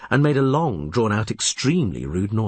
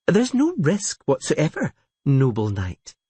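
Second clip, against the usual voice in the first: flirting; 6 semitones higher than usual. A narrator's reading. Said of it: "She smiled seductively".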